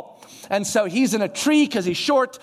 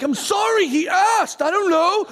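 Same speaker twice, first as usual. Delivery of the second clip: high-pitched, protesting apology; defensive bystander; 8 semitones higher than usual. Retold or imitated. Scolded bystander excusing himself to the other guy, defensive. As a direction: plead your excuse in a higher voice. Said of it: "one guy tells the other guy".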